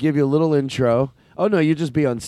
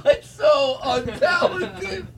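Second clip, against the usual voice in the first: high, helpless laughter through the words; laughing hard; 11 semitones higher, much slower than usual. Real, not acted. Host cracking up at the contestant's jumping-jacks talent, laughing helplessly. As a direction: wheeze it out slowly, cracking up.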